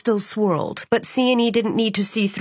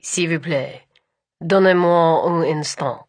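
put-on French accent; 3 semitones lower than usual. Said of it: "flawless French accent".

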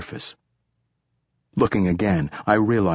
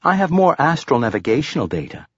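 higher chirpy computer voice; 7 semitones higher, faster than usual. A narrator's reading. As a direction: chirp it like an eager machine. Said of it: "chirped the bracelet".

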